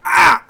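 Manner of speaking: sudden loud, high pained yelp; cry of pain. Acted. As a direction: yelp as pain stabs the eye. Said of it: "is wincing in pain".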